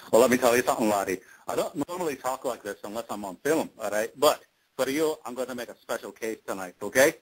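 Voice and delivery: stagey Scottish brogue; Scotty's Scottish accent. Shown as a demonstration. Actor gives the host a sample of his screen role, Scottish accent. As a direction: put on a Scottish burr. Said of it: "can you do a little sample of".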